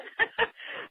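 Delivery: a quick high breathy laugh with no voice. Real, not acted. Caller laughs at herself after vowing never again. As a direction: let out a soft airy giggle.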